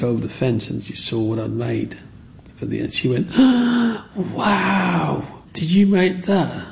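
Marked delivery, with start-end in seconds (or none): 3.3-4.1 put-on child's gasp. Child gasps at the ant houses. 4.1-6.6 put-on amazed little girl. Neighbour girl amazed at his work.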